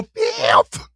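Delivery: high squeaked-out wailing fifth; mock wail. Pleading the fifth with a jokey high cry. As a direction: wail the word like a fake sob.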